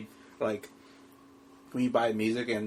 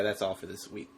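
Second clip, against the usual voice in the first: ordinary talk, nothing marked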